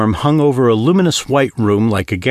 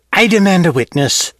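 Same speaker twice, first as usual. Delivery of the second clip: higher, sharp exasperated demand; a touch of imperious exasperation; exasperated declaration; 8 semitones higher than usual. Acted. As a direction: declare it with impatience. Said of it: "she declared with exasperation".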